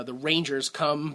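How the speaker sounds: usual speaking voice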